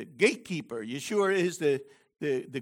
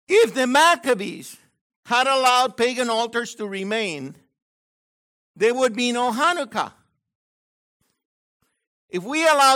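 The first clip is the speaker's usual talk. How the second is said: loud, high sermon delivery with weighty imperatives; forceful preaching; 6 semitones higher, 6 dB louder than usual. Real, not acted. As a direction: exhort the congregation, hammering each command. Preaches a call to action.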